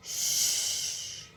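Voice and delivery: slow unvoiced hush; hushing shh. A narrator's reading. Guide hushing the girls near jackals. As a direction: hush everyone to silence. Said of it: "Ranveer uncle फुसफुसाए".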